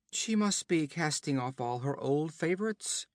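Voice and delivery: plain reading voice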